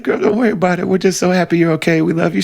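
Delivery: high, fond reassurance to a hurt friend; retold reassurance. Retelling own reply to Julie.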